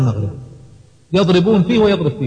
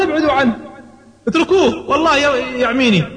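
high, panicked cry for help; acted scream; 11 semitones higher than usual. A narrator's reading. Pinned Utbah begs his men to leave Umar, acted scream. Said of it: "فصرخ عتبة".

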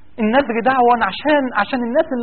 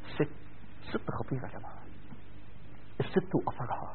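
soft, hushed whisper, much softer; whispered aside; 8 semitones lower, 18 dB quieter than usual. Real, not acted. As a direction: lean in and whisper it like a secret. Confiding aside to the audience.

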